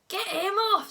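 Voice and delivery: loud, high urgent order; alarmed shout. A narrator's reading. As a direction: cry out to have the attacker removed. Stoic attacked by the dragon.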